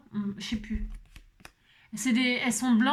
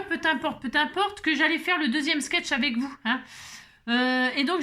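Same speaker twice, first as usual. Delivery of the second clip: ordinary delivery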